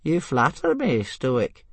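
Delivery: reply with amused modesty; touch of wry amusement; amused old man. A narrator's reading. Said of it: "who seemed rather amused".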